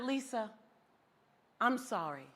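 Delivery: low, soft apology; regretful supervisor. Retold or imitated. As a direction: break bad news gently and quietly. Supervisor gently letting her go.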